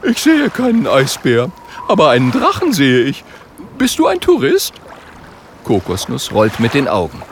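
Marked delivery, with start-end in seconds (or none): 0.0-4.7 high, loud, naive character voice; clueless little seal, curious; puzzled baby seal. A narrator's reading.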